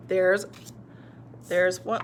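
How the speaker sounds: usual speaking voice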